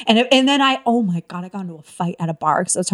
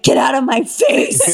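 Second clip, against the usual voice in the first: higher, mock-furious shout; retold angry yell; 4 semitones higher than usual. Comedian retelling how she saw off a bar guy, angry yell. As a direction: yell him away, re-enacted for laughs.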